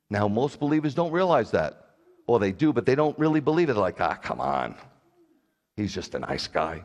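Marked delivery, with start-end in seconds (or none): 4.0-6.8 low, casual brush-off; mock dismissive. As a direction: imitate someone shrugging off the warning. Voicing casual, dismissive believers.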